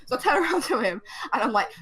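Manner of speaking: normal talking voice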